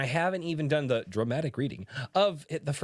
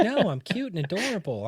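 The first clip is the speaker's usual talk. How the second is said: cute innocent voice; 7 semitones higher than usual. Retold or imitated. Voicing the cute innocent book.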